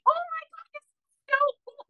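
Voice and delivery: high, thrilled squeal of joy; mother-to-be hearing of triplets, squealing with delight; giddy delight. Retold or imitated. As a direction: gush it out like the best news ever. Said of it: "I start laughing".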